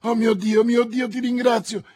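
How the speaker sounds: high, loud cry of thanks; fervent exclamation. A narrator's reading. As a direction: thank God aloud in a rush of relief. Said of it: "esclamò il giovane".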